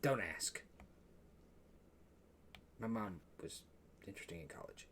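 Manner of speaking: low, very soft aside; awkward quiet admission. Acted. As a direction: drop your voice, reluctant to explain. Partner awkwardly explains his family.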